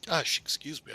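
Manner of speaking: talking through hiccups; hiccuping between words. Real, not acted. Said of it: "I got hiccups".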